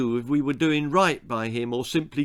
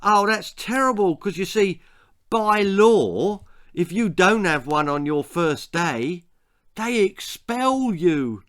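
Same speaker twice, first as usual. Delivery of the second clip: higher, louder fake sympathy; mock concern; 7 semitones higher, 4 dB louder than usual. A narrator's reading. Bully pretending concern to frighten the new boy, higher and louder. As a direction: pretend to care while mocking.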